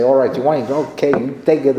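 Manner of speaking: high, loud mock defiance; defiant throwing back. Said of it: "throwing at me and say".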